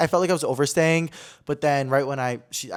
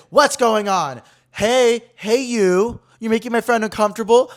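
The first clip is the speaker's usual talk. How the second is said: raised, higher calling-out voice; imitating a woman stepping in forcefully; retold intervention; 6 semitones higher, 5 dB louder than usual. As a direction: call out to challenge someone across the room. Older woman steps in, calling out.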